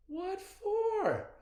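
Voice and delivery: high grandmotherly retort; Nana's voice. A narrator's reading. Nana answering CJ's wish, high and sharp. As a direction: toss it back at him.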